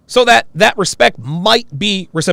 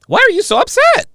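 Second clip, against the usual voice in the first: high, loud, mocking squeal; mocking high quote; 7 dB louder than usual. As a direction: mimic her shrill question, much higher. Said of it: "she asks me".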